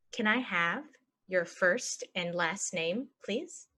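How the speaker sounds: slow, broken into chunks; slow chunked. Shown as a demonstration. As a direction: model it slowly for learners. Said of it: "normal then slow model".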